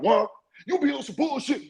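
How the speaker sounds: high, sing-song mock outburst; mock sudden scream. Acting out the friend suddenly screaming at Warren. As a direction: flip from friendly to screaming in a sung line. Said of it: "start screaming".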